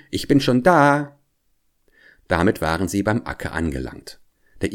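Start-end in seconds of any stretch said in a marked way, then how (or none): 0.1-1.2 high call with a drawn last word. A narrator's reading. Hedgehog shows his wife what to shout to the hare, calling out. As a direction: call it out in a raised pitch. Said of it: "so rufst du ihm entgegen".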